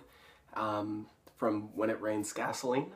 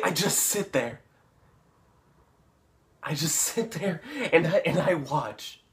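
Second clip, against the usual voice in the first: louder, higher, stammering intensity; agitated; 5 semitones higher, 7 dB louder than usual. Acted. [name] reliving his dream of Chris choking, agitated. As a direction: relive it, getting worked up.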